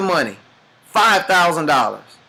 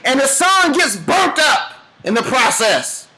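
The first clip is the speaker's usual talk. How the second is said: rising, higher sermon build-up; preaching climax; 8 semitones higher than usual. Acted. Speaker drives the parable to its peak, preaching. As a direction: push the story's climax like a preacher.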